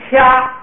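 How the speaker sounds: 掐 held on a high level tone, aspirated; slow, high, even 掐 with a puff of air; 高平调. Shown as a demonstration. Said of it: "既变高调". (高平调送气地慢念“掐”)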